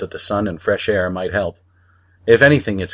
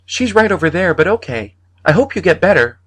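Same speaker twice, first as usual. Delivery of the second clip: put-on little boy, cheerful; a small boy saying goodbye; high child voice; 11 semitones higher, 5 dB louder than usual. A narrator's reading. Boy leaving the man, high cheerful child voice.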